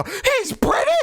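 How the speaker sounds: very high, drawn-out mocking squeal; amazed friend. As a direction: imitate a friend shrieking in astonishment, slowly. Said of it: "you're sending me a reel of him talking".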